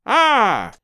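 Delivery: loud satisfied ah; triumphant exclaim at the eyepiece; cry of satisfaction. A narrator's reading. Old squire spots the boy, satisfied loud cry. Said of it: "gave a cry of satisfaction".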